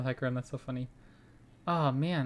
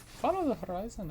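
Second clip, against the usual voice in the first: higher-pitched Targonian accent imitation; 7 semitones higher, 3 dB quieter than usual. Player echoing a game card's line, imitated Targonian accent. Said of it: "I love their accent".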